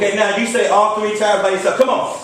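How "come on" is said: high, rallying call; hype-man interjection; urging the crowd on. Real, not acted. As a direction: spur them on between chants.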